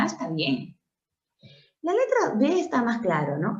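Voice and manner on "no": ordinary talk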